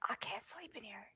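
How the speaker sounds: hushed, breathy sleepy gripe; mimicking husband's whispered complaint. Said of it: "my husband's like".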